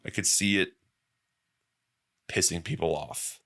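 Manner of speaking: ordinary talk, nothing marked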